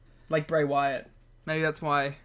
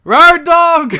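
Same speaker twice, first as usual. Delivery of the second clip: excited shout; 12 semitones higher, 16 dB louder than usual. Real, not acted. Shouting wrestling catchphrase.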